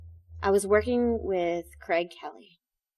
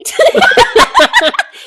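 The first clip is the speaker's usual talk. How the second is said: loud high burst of laughter; real high laugh; 17 dB louder than usual. Real, not acted. After calling the heartbreak great, a loud real laugh.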